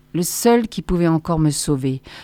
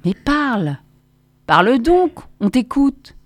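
a higher voice, almost polite, urging; sincere plea with a lifted pitch; nearly courteous, sincere; 6 semitones higher than usual. A narrator's reading. Crowd member urging the silent prisoner to speak, almost courteous. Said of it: "une voix presque courtoise et sincère".

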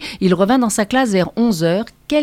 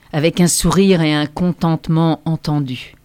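ordinary delivery, nothing marked

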